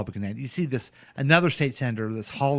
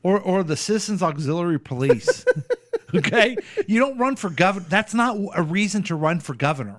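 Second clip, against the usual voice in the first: higher, worked-up indignation; exasperated rant; 7 semitones higher than usual. Real, not acted. Ranting to co-host about the candidate's ad, exasperated. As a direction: blast the idea, incredulous and fed up.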